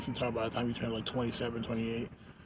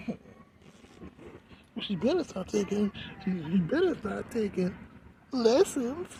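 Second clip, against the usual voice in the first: mock scolding; 9 semitones higher than usual.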